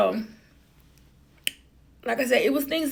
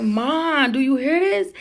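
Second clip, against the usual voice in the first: raised, higher plea to mom; calling out to mom; 5 semitones higher, 5 dB louder, slower than usual. Retold or imitated. Narrator calls out to her mom in the car, loud and disbelieving. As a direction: call out to mom, louder.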